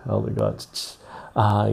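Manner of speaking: ordinary talk, nothing marked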